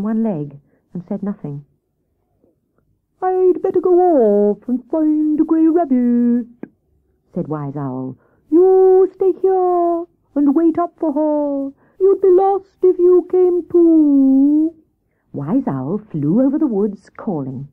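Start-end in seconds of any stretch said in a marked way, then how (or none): 3.2-6.5 high, slow hooting owl; Wise Owl voice. Acted. Owl questions Hare about the rabbit, slow hooting voice. 8.5-14.7 high, slow, loud hooting voice; commanding Wise Owl. Acted. Owl orders Hare and Squirrel, slow commanding hoots.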